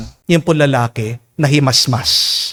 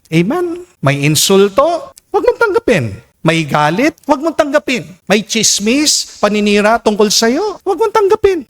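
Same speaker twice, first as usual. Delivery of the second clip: each huwag mong tanggapin higher than the last; building preaching refrain; 7 semitones higher than usual. Acted. Preacher drilling the congregation to reject insults, building higher. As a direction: climb the refrain to a peak.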